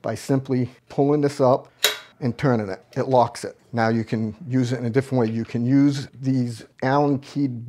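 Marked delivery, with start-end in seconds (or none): none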